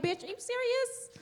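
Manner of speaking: incredulous, laughing. Retold or imitated. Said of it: "I'm like".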